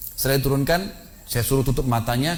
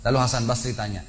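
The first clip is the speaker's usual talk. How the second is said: usual speaking voice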